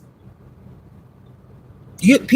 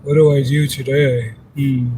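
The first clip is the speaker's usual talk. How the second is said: lazy mock quote; 4 semitones lower than usual. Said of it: "They just sitting there".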